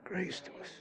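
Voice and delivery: real emotion, barely audible; choked up. Real, not acted. Preacher thinks of his grace, choked up. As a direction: voice nearly gone with feeling. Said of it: "I get choked up".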